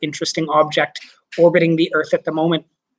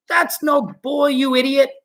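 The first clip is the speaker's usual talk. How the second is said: shouted; 8 semitones higher than usual. Acted. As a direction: insult him, exasperated. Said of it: "shouted ground control".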